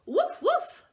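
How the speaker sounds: very high, loud yappy barks; barking dog. Acted. Parent reading to a child, dog barks high and loud. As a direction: bark it out like an excited puppy. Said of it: "barked the dog".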